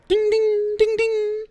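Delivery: high, ringing ding-ding; imitated bell dinging. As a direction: sing out a high-pitched bell chime. Imitating a sound heard in street.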